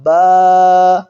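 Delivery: با said smooth and short, with no stretch and no jolt; soft ba. Shown as a demonstration. Teacher shows students the correct soft با. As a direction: give با a gentle, clean short vowel. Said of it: "حرکات کو نرمی سے پڑھتے ہیں".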